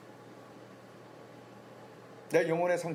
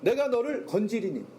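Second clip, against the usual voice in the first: 내가 punched high at the start; stress on 내가; 4 semitones higher than usual. Shown as a demonstration. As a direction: hit 내가 high, then let the line fall. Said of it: "주어가 하나님이시길 바라는".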